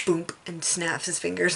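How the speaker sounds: ordinary talk, nothing marked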